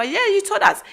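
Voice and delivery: high-pitched retold child answer; mock child's voice, high; children's reply. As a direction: imitate kids agreeing, pitched well up. Mother voices children's reply again.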